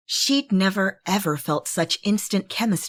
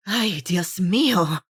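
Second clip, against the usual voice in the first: breathless gasp; gasping for air; out of breath. Acted. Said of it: "still out of breath".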